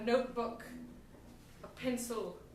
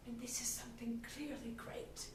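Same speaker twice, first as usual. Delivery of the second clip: whispering; 12 dB quieter than usual. Acted. Captain musing on her ambition.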